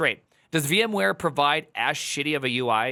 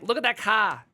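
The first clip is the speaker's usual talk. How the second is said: imitating New Zealand accent; 8 semitones higher than usual. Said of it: "they're like".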